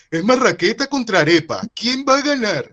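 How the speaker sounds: high, loud hyped-up announcer voice; mock a cheesy sports promo; mocking ad hype. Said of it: "estupideces como".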